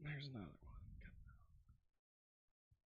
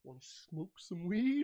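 slow, raised, hammed-up impression; Animal House professor; 4 semitones higher than usual. Host mashing up two movie roles, hammed-up professor impression. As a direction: stretch it out as a goofy film quote. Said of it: "I used to quote his role in Animal House while".

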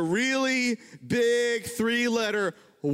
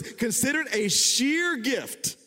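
scripture reading; 6 semitones higher, much slower than usual. Acted.

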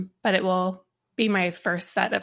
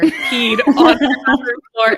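laughing through the words; 4 semitones higher, 8 dB louder, slower than usual. Real, not acted. Telling friends about the shower mishap, laughing. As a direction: giggle out the embarrassing part.

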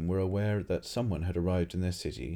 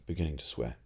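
usual speaking voice

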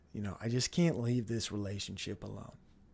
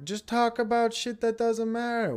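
higher, sneering mimicry of the crowd; mocking quote; 9 semitones higher, 3 dB louder than usual. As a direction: parrot them with a sneer. Mocking what everybody says.

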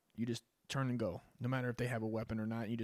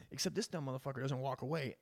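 ordinary talk, nothing marked

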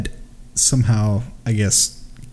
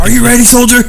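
drill sergeant; 13 semitones higher, 10 dB louder than usual. Pretend. Mock army drill scene.

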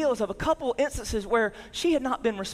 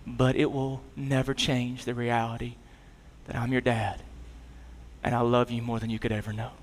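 tender reassurance; 7 semitones lower than usual. Retold or imitated. Father reassuring his sobbing son at night, tender and low. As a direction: hold his face and reassure him gently.

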